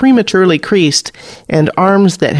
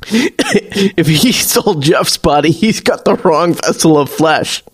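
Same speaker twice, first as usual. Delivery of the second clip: strained after coughing; 2 semitones higher than usual. A narrator's reading. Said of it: "he finally manages".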